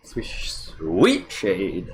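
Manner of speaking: very slow, savoring drawl; drawn out. Acted. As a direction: stretch every word out lovingly. Savoring the beer's colour.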